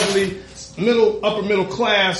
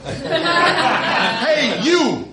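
high, laughing hey you; lightly voiced cop shouting after him; mock cop call; 10 semitones higher than usual. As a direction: imitate the cop calling him, half laughing. Cop calling out, retold.

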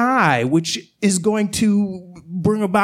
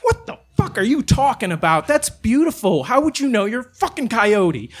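high-pitched, offended protest; incredulous retort; 4 semitones higher than usual. Retold or imitated. Man defends skyline to coyote, incredulous and high. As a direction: argue back in higher-pitched disbelief.

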